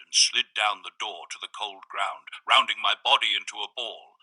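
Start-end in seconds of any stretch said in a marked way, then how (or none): none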